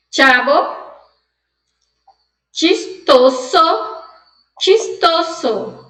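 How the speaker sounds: CHAVO "tchabo", CHISTOSO "tchistoso"; Spanish CH. Shown as a demonstration. Teacher reading the word list aloud, Spanish CH. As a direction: slowly model Chavo and Chistoso with "tch" onset. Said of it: "ler com o som de che".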